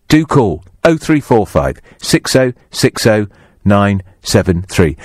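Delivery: usual speaking voice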